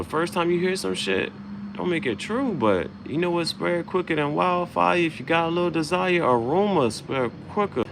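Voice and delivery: high put-on voice. Sudden shift in delivered lines.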